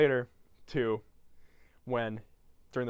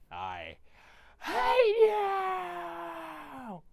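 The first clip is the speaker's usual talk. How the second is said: very high, drawn-out anguished yell; hammed-up wail; 3 dB louder, much slower than usual. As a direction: wail the hate at the top of your voice. Grinch reading hate list.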